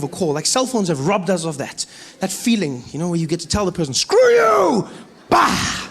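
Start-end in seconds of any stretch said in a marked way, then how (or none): 3.9-5.9 high, loud hang-up yell; mock angry shout. Caller telling someone off before slamming the phone, shouting.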